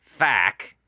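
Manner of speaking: fuck said the Australian way with a short flat vowel like "fack". Shown as a demonstration. Host shows how Australians say fuck, in an Australian accent. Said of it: "How do Australians say that word?".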